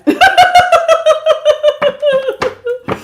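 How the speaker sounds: long high-pitched cackle; helpless shrieking laugh; high burst of laughter. Real, not acted. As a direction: break into a loud high laugh. Laughing at the chat.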